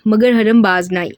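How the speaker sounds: ordinary delivery, nothing marked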